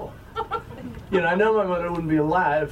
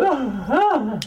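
high, wailing fake sobs; hammed-up bawling; mock crying; 8 semitones higher, 3 dB louder than usual. Son imitating his mother's crying, mock wailing. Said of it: "she cried".

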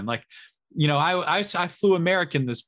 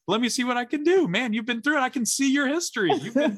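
lifted, higher, eager-to-help agent voice; helpful airline agent; 10 semitones higher, 3 dB louder than usual. Retold or imitated. Agent sympathizing with a stranded traveler, higher and eager. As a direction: play the kind agent who wants to fix it. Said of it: "And she's like".

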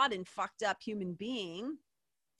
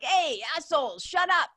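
yelled at crowd; 7 semitones higher, 7 dB louder than usual. Retold or imitated. Uncle silences the noisy Christmas table, loud mock yell. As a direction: bark it over the party noise.

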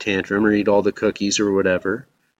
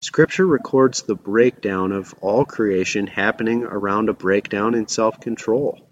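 ordinary delivery

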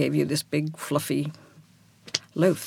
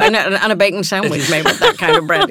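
real laugh running through the words, higher and louder; laughing agreement; 5 semitones higher, 10 dB louder than usual. Real, not acted. Guest adding to the host's toast memory, laughing. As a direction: chime in loudly through laughter.

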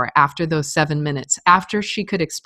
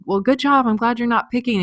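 higher, bright-pitched praise to her daughter; encouraging mom; 6 semitones higher than usual. Retold or imitated. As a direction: sound like a proud parent cheering her on, pitched up.

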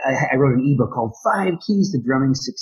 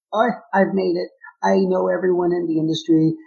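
higher-pitched imitation of a self-satisfied bragger; mock boasting; 8 semitones higher, 3 dB louder than usual. Drummer quoting the guy he never wants to be, mock boasting. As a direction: act out someone crowing about having made it. Mimicking a cocky industry insider.

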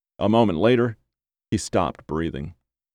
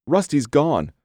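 higher, distressed announcement of a loss; acted tearful upset, pitched up; verge of tears; 6 semitones higher than usual. A narrator's reading. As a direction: break bad news near tears. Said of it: "Her voice trembled on the verge of tears".

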